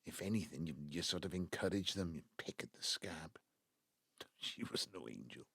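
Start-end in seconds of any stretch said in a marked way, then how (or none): 2.2-5.4 low breathy whisper; whispered bitterly. Acted. Father admitting his own fault, whispered. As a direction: let it sink to a hiss of regret.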